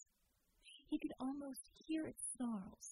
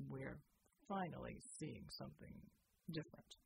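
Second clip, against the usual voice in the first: a lightly lowered, soft gruff soldier; Bulwark's low voice; 8 semitones lower, 6 dB quieter than usual. Acted. Bulwark calling Jeannie over to the armor, low and soft. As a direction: quietly point something out, voiced low.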